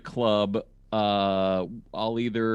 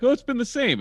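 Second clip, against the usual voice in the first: high mock voice; 14 semitones higher, 3 dB louder than usual. Mimicking people in denial.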